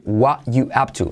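"what you up to" as "wa yu ap tu"; ARE omitted. Shown as a demonstration. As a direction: run "what you up to" together, T of "what" dropped. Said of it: "el are lo omitimos". ("what you up to" sin "are", como "wa yu")

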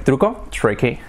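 usual speaking voice

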